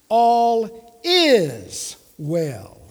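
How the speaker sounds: "is" held long and punched in "All is well"; loud, drawn-out "is" to insist on the present; stress on IS. Shown as a demonstration. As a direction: slowly declare it, leaning hard on "is". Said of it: "Not was, not will be".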